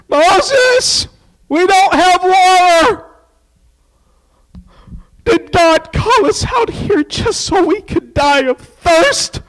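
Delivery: high nasal whine to Moses; whiny Israelites. Acted. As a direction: whine it like a thirsty crowd. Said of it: "They whined".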